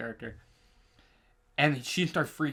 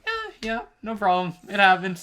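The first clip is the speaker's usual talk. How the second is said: high-pitched, dismissive imitation; imitating someone shrugging it all away; mock nonchalance; 7 semitones higher, 6 dB louder than usual. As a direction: brush it off in a put-on high voice. Retells the dude brushing it off.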